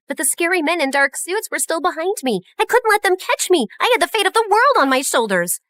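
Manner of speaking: ordinary delivery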